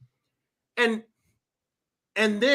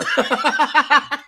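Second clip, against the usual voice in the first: real laugh; 5 semitones higher than usual. Real, not acted. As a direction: burst out laughing in quick pulses. Laughs at his mock panic.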